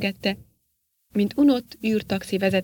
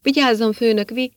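warning shout; 3 semitones higher, 6 dB louder than usual. Acted. Henchman yells to warn the boss, loud cut-off shout. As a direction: shout the warning as the shot comes. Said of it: "hogy felüvölcsön".

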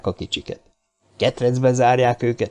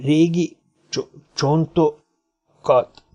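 slow, broken stammer at higher pitch; frightened stammer; 5 semitones higher, much slower than usual. A narrator's reading. Little man terrified at mention of bones, stammering. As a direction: stretch and break the words in alarm. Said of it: "megrémült a kis ember a csontok hallatán".

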